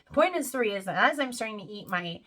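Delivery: usual speaking voice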